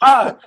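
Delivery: sudden high loud "Ah!" of being caught; startled yelp. Retold or imitated. Caught by the coworker, high startled yelp. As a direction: yelp out in mock shock.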